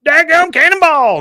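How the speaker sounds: Patch voice. Retold or imitated. Quoting Patch in recap.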